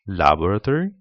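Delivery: "laboratory" said la-BOR-a-tory, British way; British "laboratory", stress on the second syllable; British English. Shown as a demonstration. Teacher shows varieties, "laboratory" in British English. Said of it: "different varieties".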